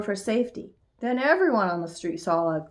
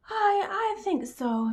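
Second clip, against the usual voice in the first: high, slow little mouse voice; drawn-out, high-pitched sleepy hesitation; 13 semitones higher, slower than usual. A narrator's reading. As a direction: answer drowsily in a tiny high voice. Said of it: "said Magical Mouse sleepily".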